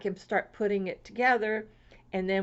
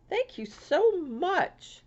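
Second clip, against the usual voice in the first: gushing thanks; 9 semitones higher, much slower than usual. Real, not acted. Thanking sender.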